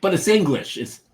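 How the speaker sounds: high, loud imitation of an Italian saying very nice; playful Italian-accented very nice. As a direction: mimic the Italian bar owner's accent. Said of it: "that was his accent".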